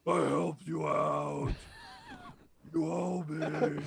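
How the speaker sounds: loud, slow Batman shout. Pretend. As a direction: demand it like Batman. Batman demanding payment, voiced.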